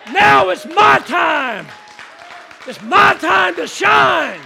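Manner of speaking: loud, slow, rousing shout; preacher leading a shouted declaration. Real, not acted. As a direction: belt it out like a rally cry. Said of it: "Now tell somebody".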